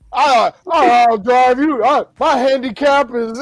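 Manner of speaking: mock debater boast. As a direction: ham up the bragging candidate, drawn out and shouted. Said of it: "when they went off about golf".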